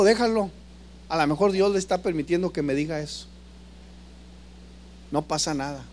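David softly calming his warrior; calm king. Retold or imitated. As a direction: let it go quietly, unbothered. David calmly restraining his soldier.

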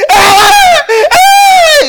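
very high, loud mock bawling; imitated loud wail. Sister crying to her preacher with all she has, mock high wail. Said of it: "cuando yo llore, llore con todo".

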